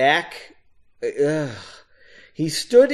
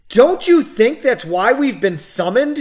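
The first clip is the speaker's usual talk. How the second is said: high, loud insistent question; irritated; 7 semitones higher, 6 dB louder than usual. A narrator's reading. Woman pressing her companion with raised hands, irritated. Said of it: "Margot was clearly irritated".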